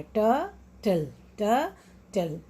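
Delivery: टर्टल slowly split as "ter... tul"; syllable by syllable. Shown as a demonstration. Teacher shows the added syllable, टर्टल slowly split in two. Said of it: "एक पूरा syllable बना रहा है".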